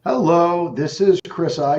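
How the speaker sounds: high, loud sales hello; phone greeting voice. Real, not acted. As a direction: greet the caller with energy. Cold call greeting.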